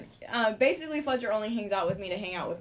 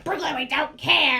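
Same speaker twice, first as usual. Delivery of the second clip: annoyed group retort; 6 semitones higher, 7 dB louder than usual. Retold or imitated. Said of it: "everybody was like".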